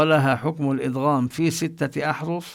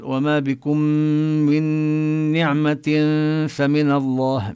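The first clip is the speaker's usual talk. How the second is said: Quran recitation. Shown as a demonstration. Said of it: "مثل". (تلاوة مجودة)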